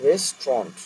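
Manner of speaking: "রেস্টুরেন্ট" repeated as "res-tront"; correct pronunciation. Shown as a demonstration. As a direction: say "res-tront" carefully, falling at the end. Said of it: "কিন্তু কথাটা রেস্টুরেন্ট".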